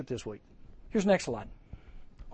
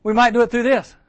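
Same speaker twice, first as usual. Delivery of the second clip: higher and louder, pressing the point; louder emphatic; 6 semitones higher, 7 dB louder than usual. Shown as a demonstration. Preacher repeating his point to campers, louder. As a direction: land it harder on the crowd. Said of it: "This big letter that I get".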